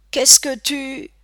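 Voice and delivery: high, loud, drawn-out challenge; taunting call. A narrator's reading. Bully Luke caught off by the girl's arrival, taunting. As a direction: throw it out slowly and high. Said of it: "lança Luke à Ben avec son rire aigu".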